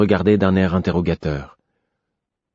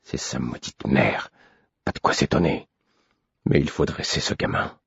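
muttered; 4 semitones lower, 5 dB quieter than usual. A narrator's reading. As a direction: grumble under your breath. (marmonné pour soi)

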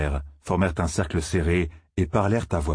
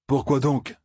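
bitter tone; 7 semitones higher, slower than usual. A narrator's reading. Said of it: "d'un ton amer".

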